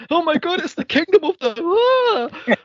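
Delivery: high hammed-up Bible panic ending in a shriek; frantic mock shriek. Podcaster mocking the wife reading the Bible, shrieking. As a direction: overplay her terror at the scripture. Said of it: "Runs to the Bible and goes".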